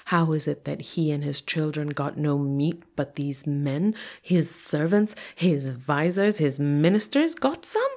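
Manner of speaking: building outrage. A narrator's reading. King fumes that servants got meat, building outrage. Narrating the fuming king's thoughts.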